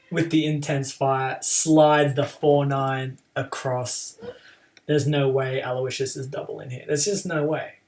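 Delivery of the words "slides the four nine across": ordinary talk, nothing marked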